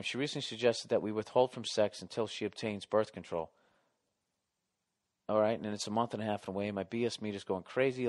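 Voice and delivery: fast, mumbled reread under his breath; quick reread. Shown as a demonstration. Host rereads the letter to check again, fast and muttered. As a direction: skim back through the letter to yourself. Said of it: "Let me reread this".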